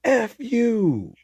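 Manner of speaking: high mock shout across the water; hammed-up far-off yell; yelled insult. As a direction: fling the insult across the sea. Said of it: "He goes".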